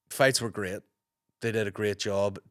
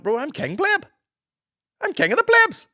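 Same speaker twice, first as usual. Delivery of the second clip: much higher, louder jokey proclamation; hammed-up triumphant yell; mock shout; 9 dB louder than usual. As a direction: belt it out like crowning yourself. Retorting to fans at a gig.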